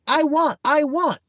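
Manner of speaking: put-on high, insistent child's whine; whining toddler. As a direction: beg like a small child.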